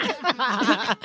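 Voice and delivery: short high burst of laughter. Real, not acted. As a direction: break into a chuckle mid-story. Laughs at his own story.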